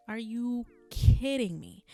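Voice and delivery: slow, loud exasperated outburst. Retold or imitated. Annoyed at her tears, loud and slow. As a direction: drag it out, fed up and loud.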